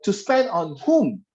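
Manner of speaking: "to spend on whom" read slowly, falling on "whom"; slow question. Shown as a demonstration. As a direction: draw out "whom" as a real question. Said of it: "You should pronounce this".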